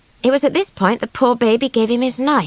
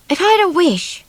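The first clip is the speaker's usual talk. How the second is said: a lightly voiced young girl, higher; girl's voice; 9 semitones higher, 3 dB louder than usual. A narrator's reading. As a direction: wish it in a small girl's higher voice. Swallow, a girl, wishes aloud.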